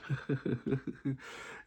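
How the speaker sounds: short run of breathy chuckles; imitated laugh. Telling how Jeff reacted to the news, chuckling. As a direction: chuckle the way he did on hearing it. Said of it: "He start laughing".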